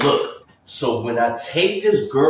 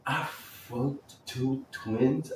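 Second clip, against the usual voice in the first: slow, much softer, dazed question; slow hushed shock; stunned disbelief; 12 dB quieter, much slower than usual. Retold or imitated. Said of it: "And I'm like".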